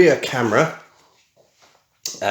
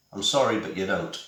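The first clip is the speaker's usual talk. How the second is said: ordinary talk, nothing marked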